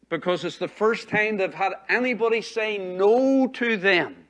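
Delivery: slow, loud build to a drawn-out NO; emphatic preaching. Real, not acted. Preacher telling congregation why unspanked kids fight police, emphatic and loud. Preacher driving home lawlessness point.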